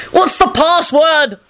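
high, drawn-out frantic yell; wild yell. A narrator's reading. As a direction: yell wildly, stretching the words. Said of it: "Lupin yelled wildly".

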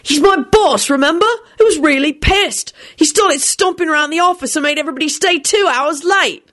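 high-pitched aggrieved rant; indignant complainer. A narrator's reading. Indignant character keeps arguing.

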